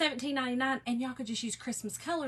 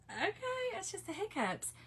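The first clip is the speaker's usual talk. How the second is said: higher mock husband voice; imitating husband; 8 semitones higher, 6 dB quieter than usual. Wife mimicking her husband brushing off his hiccups, higher. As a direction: lightly mimic the husband shrugging it off. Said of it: "And he's like".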